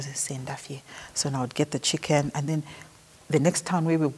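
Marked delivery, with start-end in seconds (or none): 0.0-0.8 very soft, hushed aside; quietly retold whispered prompt; imitated whisper. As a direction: say it discreetly under the breath. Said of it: "he would whisper".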